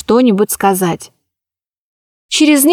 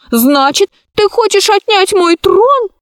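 put-on high, shrill accusing child; a spoilt heiress pouting at a rival; indignant little girl; 9 semitones higher than usual. A narrator's reading.

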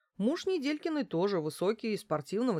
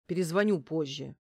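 brief grudging brush-off; understated disgruntled investigator; displeased grumble; 3 semitones lower, 3 dB louder than usual. A narrator's reading. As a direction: grumble it, mildly put out. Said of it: "пробурчал недовольно следователь".

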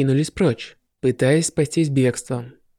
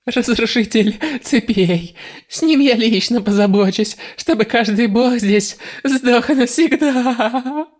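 spat out; 6 semitones higher, slower than usual. Acted. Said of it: "Буквально выплюнув эти слова".

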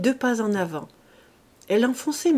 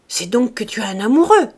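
loud outburst climbing sharply at the end; shouted accusation; 9 dB louder than usual. A narrator's reading. Farmer accusing his servant of having a lover, shouting. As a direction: shout it in her face. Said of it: "lui cria dans la face".

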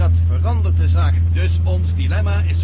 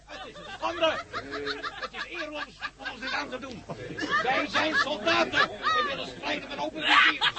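indignant protest; 10 semitones higher, 9 dB quieter than usual. Acted. Captured soldiers protest, others laugh.

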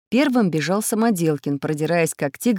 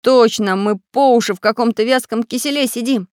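raised, louder voice; alarmed agreement; 5 semitones higher, 5 dB louder than usual. A narrator's reading. Самоделкин realising they sit in goo, dismayed. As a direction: exclaim it in dismay.

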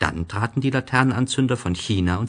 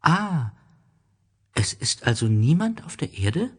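little prince; 9 semitones higher than usual. Acted. Prince asks the snake.

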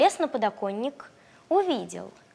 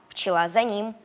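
usual speaking voice

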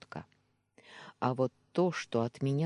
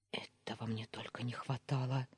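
softer whispered grumble; grumbling; 7 dB quieter than usual. A narrator's reading. Heroine grumbles under her breath to her friend. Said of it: "заворчала я".

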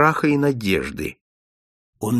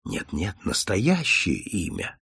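much lower, softer and slow, kindly; gently; 5 semitones lower, 6 dB quieter, slower than usual. Acted. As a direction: coax the real name out of him. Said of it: "ласково сказал Холмс". (ласково, тихо и медленно)

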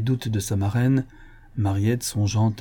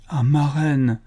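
higher, understated tearful plea; 4 semitones higher, slower than usual. A narrator's reading. Mariette pleading with her godmother, tearful. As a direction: plead through lightly acted tears. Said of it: "ne pouvant retenir ses larmes".

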